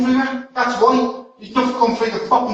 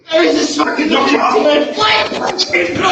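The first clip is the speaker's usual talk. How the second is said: real distressed yelling; 3 semitones higher than usual. Real, not acted. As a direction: yell out while struggling against restraint. Restrained man yelling during a struggle.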